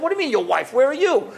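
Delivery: high, baffled demand; incredulous questioning. Retold or imitated. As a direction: fire back in disbelief, pitch climbing. Retold incredulous question to father.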